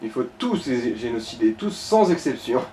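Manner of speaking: usual speaking voice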